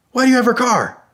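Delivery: demanding husband. Retold or imitated. Husband confronting stranger in wife's car, loud high demand. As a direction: imitate a husband challenging a stranger.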